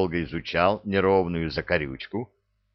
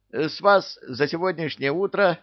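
high-pitched servant voice; obliging vendor; 10 semitones higher than usual. A narrator's reading. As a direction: announce the bill in a high voice. Servant figure at the machine.

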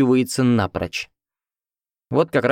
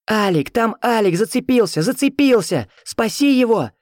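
high, frantic woman's cry; desperate shouting; 12 semitones higher than usual. A narrator's reading. Woman calling after the rescuer, high desperate shouting. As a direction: cry for help after someone. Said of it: "кричала мне вслед".

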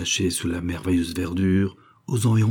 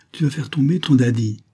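higher-pitched mock reproach; playful scolding; 6 semitones higher, 4 dB louder than usual. A narrator's reading. Mother warns daughter rocking on daddy's lap, playful scolding. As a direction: scold lightly, too amused to mean it. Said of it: "la mère voulant gronder, mais ne pouvant que rire".

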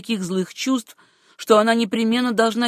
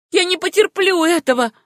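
high, loud, angry refusal; impassioned, raised-voice defiance; threatening protest; 10 semitones higher, 5 dB louder than usual. A narrator's reading. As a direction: refuse it with rising menace. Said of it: "повторила Сюзанна и угрожающе надвинулась".

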